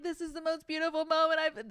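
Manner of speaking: high-pitched mock rapture; imitated overwhelmed emotion. Imitating a jumper floating through the canyon, overwhelmed. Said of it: "so emotional".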